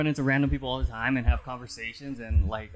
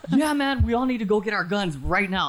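high mock call to arms, half laughing; urgent old man; 8 semitones higher than usual. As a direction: imitate an old man rallying his neighbour. Old man urges going for guns.

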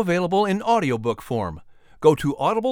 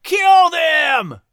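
much higher, loud shout; angry shout; 13 semitones higher, 5 dB louder than usual. Acted. Angry crowd shouting at the redcoats, furious. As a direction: bellow it from the crowd. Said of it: "other angry voices echoed".

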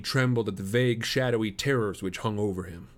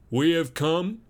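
lightly voiced, higher and louder; formal suitor; 7 semitones higher, 5 dB louder, slower than usual. Suitor announcing his errand to the girl's father, lightly formal. Stangerson states his suit.

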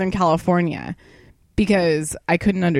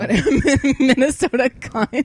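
slow, higher, laughing through the words; 6 semitones higher, much slower than usual. Real, not acted. Co-host repeating the fake punk band name, laughing.

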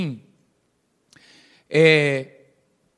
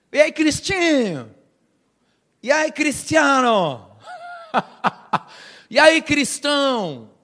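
higher, slow, teasing street greeting; playful greeting; 6 semitones higher, slower than usual. Acted. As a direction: hail a buddy with a drawn-out grin. Mock greeting to disciples. (saudação brincalhona, aguda e arrastada)

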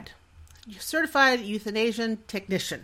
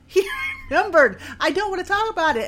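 inner scream; 10 semitones higher, 5 dB louder than usual. Retold or imitated. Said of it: "I'm screaming on the inside".

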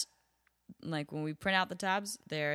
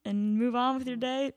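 higher, coaxing plea to a customer; pleading server; 5 semitones higher, 4 dB louder than usual. Retold or imitated. Server bargaining with old lady, higher coaxing plea. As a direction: sweet-talk them into giving up the table. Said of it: "this is my real voice".

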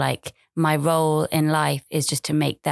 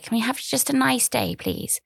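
put-on pleading child; 7 semitones higher than usual. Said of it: "she was like".

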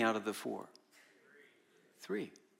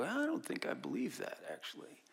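imagined believer; 9 semitones higher, 7 dB quieter than usual. Pretend. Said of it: "You should go".

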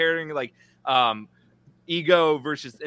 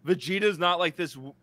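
usual speaking voice

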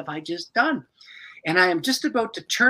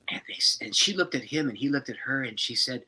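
ordinary talk, nothing marked

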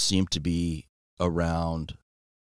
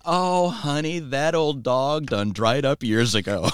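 high, drawn-out grandma voice; slow, higher-pitched old-lady punchline; imitated grandma; 7 semitones higher, slower than usual. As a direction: imitate a folksy grandma confiding frankly. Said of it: "And she goes".

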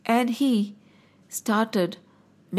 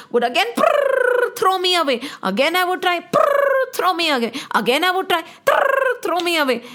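much higher rolled prrr, then thrown away; flicking prrr sound; 7 semitones higher than usual. Retold or imitated. Turtle telling of being flung away, high prrr trill. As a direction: trill the flick like a tail sweep.

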